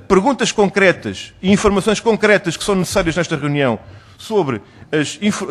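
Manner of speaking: usual speaking voice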